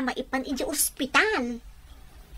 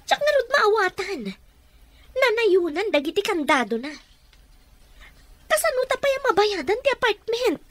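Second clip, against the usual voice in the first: higher, pressing alarm; panicked plea; 7 semitones higher than usual. Acted. Wife panicking about the locked house. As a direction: push the worry up and out.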